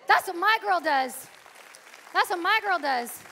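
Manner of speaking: loud, high proud declaration; God boasting about his girl, loudly; proud exclaim. Retold or imitated. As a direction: announce it with pride. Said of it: "Lord praising her loudly".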